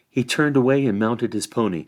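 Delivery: plain reading voice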